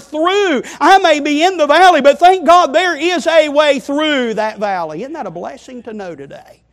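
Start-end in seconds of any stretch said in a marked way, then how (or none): 0.0-0.6 "through" lifted high and falling away; stress on through. Shown as a demonstration. Preacher to congregation, lifting "through". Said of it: "He places it on the word". 0.8-5.0 rising fervour. Shown as a demonstration. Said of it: "That's not where our shepherd places the emphasis".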